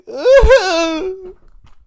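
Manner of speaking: high, loud squealing laugh; delighted squeal of laughter; shrieking laugh. Real, not acted.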